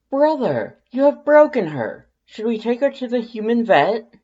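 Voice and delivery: very high, loud, dramatic alarm; excitable skeleton Papyrus. A narrator's reading. Papyrus frets to Sans about the sneezing human, high loud alarm. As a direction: panic like a theatrical hero who thinks his friend broke.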